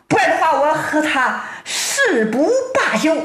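high-pitched, outraged appeal; storyteller's aggrieved character; indignant complaint. A narrator's reading. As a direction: demand justice with emphatic, rising indignation. Robbed man demands justice, acted quarrel.

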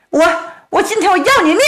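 high, fast desperate cry; the heroine threatens the bandit, fast and high. Acted. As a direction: lash out in panic.

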